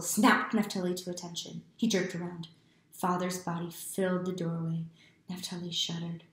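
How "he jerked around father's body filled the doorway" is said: usual speaking voice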